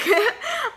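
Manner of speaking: high squealing laugh; burst of high laughter. Real, not acted. Telling viewers her silly mistake, high squeal of laughter. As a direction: laugh out at herself.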